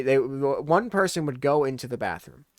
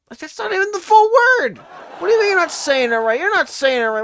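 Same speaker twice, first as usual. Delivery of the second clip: loud, high, incredulous yelling; real exasperated outburst; exasperated shout; 10 dB louder than usual. Real, not acted. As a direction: shout in disbelief at her. Exasperated rant at mom.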